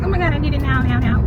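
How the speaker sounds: high, urgent demanding; urgent patient demand. Retold or imitated. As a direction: beg like it can't wait. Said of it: "where they're like".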